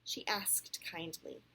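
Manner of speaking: clear, animated read-aloud; storybook voice with lively rises and falls; picture-book narration. A narrator's reading. Parent reads Sam's story to a child, animated storytelling.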